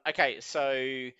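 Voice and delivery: normal talking voice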